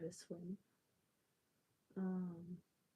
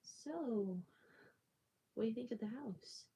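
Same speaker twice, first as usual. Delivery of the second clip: higher-pitched, mock house-seller lilt; imitating the lady; 4 semitones higher than usual. House seller asking soaked child's parents, imitated in higher voice. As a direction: pitch it up as the lady making her pitch. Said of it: "the lady proceeds to ask my parents".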